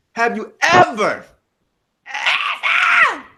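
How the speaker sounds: high, drawn-out preacher's cry on "ever"; mock-preacher delivery, rising to a shouted "ever". Host appeals to the brethren like a preacher, preacher voice. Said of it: "get my preacher voice".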